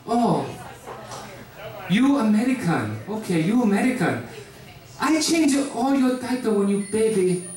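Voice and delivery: mock him sweetly in a Korean mom accent. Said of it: "She was like".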